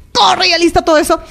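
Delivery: high-pitched exasperated mom order; mock yelled order. Mother hurrying her daughters to get ready, mock yelling. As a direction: pretend to yell at dawdling kids. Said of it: "y no grites".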